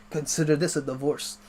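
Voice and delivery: lightly voiced Austrian action hero; deadpan movie one-liner in an accent; a hint of Terminator-style impression; Arnold Schwarzenegger. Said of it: "When I try and do a Terminator voice".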